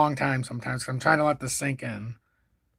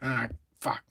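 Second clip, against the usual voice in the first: usual speaking voice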